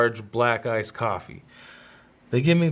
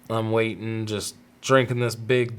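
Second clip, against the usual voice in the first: ordinary talk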